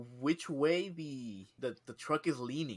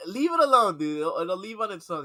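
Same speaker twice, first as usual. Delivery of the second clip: higher, louder urging; exasperated call; 6 semitones higher, 9 dB louder than usual. Retold or imitated. Telling coworker to leave the moth, exasperated call. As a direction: tell him off, fed up. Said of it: "I was like".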